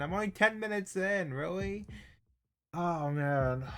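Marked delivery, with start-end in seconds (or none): 0.0-1.9 high, disbelieving repeat of "ten"; can't believe it's only been ten; higher, exasperated second take; incredulous. Real, not acted.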